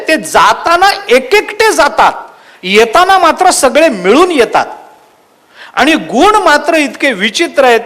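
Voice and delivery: preaching. Acted. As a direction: drive the moral home to the congregation. Preacher draws the moral forcefully. (जोरकस प्रवचन, ठासून सांगणे)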